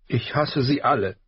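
sniffling. A narrator's reading. Arya confessing hatred to her father, sniffling. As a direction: spit it out through sniffles.

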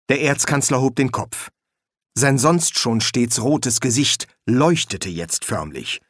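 ordinary delivery

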